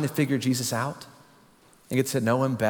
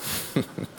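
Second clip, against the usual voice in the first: breathless laugh; 8 dB quieter than usual. Real, not acted. Laughing at own prank story.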